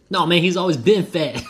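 laughing through the punchline. Retold or imitated. Buddy's retort retold with laughter.

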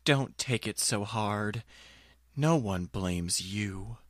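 ordinary talk, nothing marked

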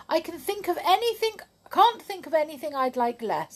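high, quick and chirpy; contrary girl. Acted. Miss Contrary turns down Happy's tea, high and quick. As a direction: rattle it off high and bright.

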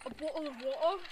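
attempted British bottle of water; British accent. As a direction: imitate a British accent, questioning. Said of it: "Bro has a British accent".

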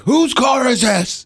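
slow, high, sing-song yell; drawn-out mock angry call; mock shout. Cohost imagining himself confronting the car owner, slow sing-song mock yell. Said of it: "would've walked out there and just been like".

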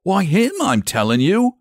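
high-pitched, insistent rustic; worked-up shepherd voice; agitated shepherd. Acted. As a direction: insist as a rattled country shepherd.

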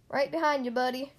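ordinary delivery

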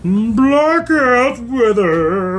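drawn out. Real, not acted. Describing strong beers.

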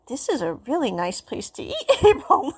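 laughing through the words; giggle, then the name. Retold or imitated. Quote broken by laughter.

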